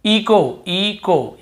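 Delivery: "इको" with a hard k. Shown as a demonstration. Teacher models the word for students, k sound. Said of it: "इको ही पढ़ेंगे".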